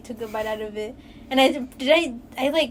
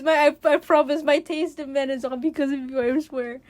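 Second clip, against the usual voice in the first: normal talking voice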